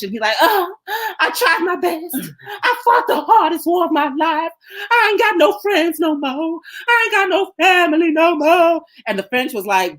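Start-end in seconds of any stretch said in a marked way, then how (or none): 0.4-8.8 high, whiny mock sobbing plea; mock crying plea. Rago crying to the French, high mock whining sob. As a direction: whine it like a beaten man begging for pity. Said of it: "he crying to the French".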